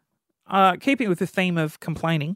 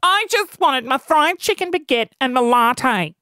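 ordinary talk

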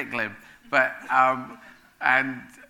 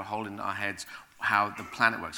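usual speaking voice

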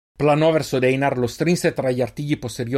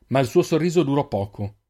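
ordinary delivery

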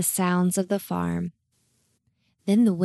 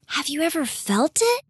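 put-on child voice; 8 semitones higher than usual. Child Naomi voiced eagerly.

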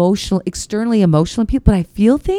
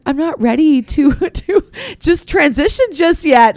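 imitating tender choke-up; 7 semitones higher, slower than usual. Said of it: "you were so tender".

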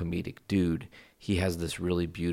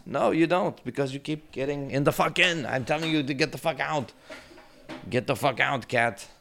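yelling at the cat; 9 semitones higher than usual. Real, not acted. Owner yelling at his cat to get off the table, angry and high.

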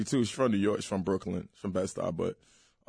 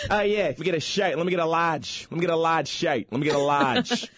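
high, animated Boston order; hammed-up Boston with dropped Rs; Boston accent; 7 semitones higher than usual. Shown as a demonstration. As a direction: order like an eager Bostonian, pitched up. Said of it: "How about Boston?".

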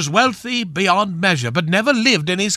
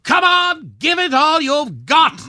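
joke-teller bellowing as Camilla; mock bellow; 8 semitones higher than usual. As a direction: egg him on in a mock bellow. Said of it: "Camilla bellowed".

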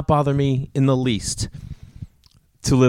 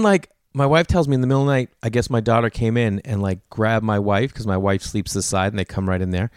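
usual speaking voice

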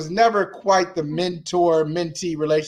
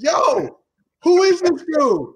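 higher and louder, hammed-up what-the; mock baffled outburst; 12 semitones higher, 4 dB louder than usual. Mentor retelling his reaction to the intern's voicemail, mock baffled outburst. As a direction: playfully exclaim at a stranger's message.

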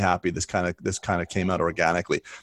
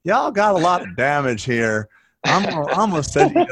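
mock Southern accent; 7 semitones higher than usual. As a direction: ham up a Southern accent, laughing. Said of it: "trying to put on a Bruce Baird Southern accent".

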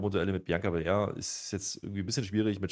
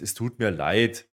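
lightly acted apology, higher and louder; mock Heyman apology; 6 semitones higher, 4 dB louder than usual. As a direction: play a fake, over-sorry apology, climbing in pitch. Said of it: "er entschuldigt sich jetzt".

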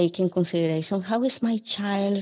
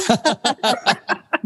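short amused laugh, pitch up; bright laugh; 5 semitones higher, 4 dB louder than usual. Real, not acted. Luz laughs at the South Memphis accent joke.

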